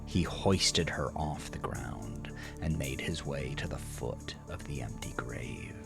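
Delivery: plain reading voice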